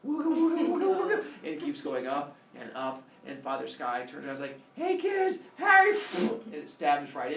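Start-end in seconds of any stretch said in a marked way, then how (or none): 0.1-1.2 high, rising wooga warble; whirling spear noise. Acted. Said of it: "the spear just keeps on going". 4.8-5.9 very high hail, getting louder; cheery call cut short. Acted. Father Sky turns, about to be hit.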